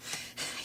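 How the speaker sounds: silent wheezing laugh; can't stop laughing, high squeak; real breathless giggle; helpless laughter. Real, not acted. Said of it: "I can't".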